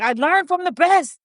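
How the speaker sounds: mock proud chest-patting boast; imitating Letitia James. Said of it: "she was like".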